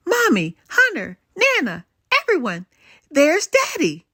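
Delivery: high, loud calling out names; excited calling. Acted. Girl spots her returning father and calls her family, thrilled. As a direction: shout the news to everyone.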